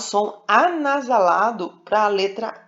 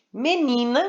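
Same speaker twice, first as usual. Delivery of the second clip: "menina" mais agudo, com NI destacado; ênfase na penúltima sílaba; 5 semitones higher than usual. Shown as a demonstration. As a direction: carregue no NI de "menina". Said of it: "a sílaba tônica é a penúltima". ("menina" com peso na sílaba do meio)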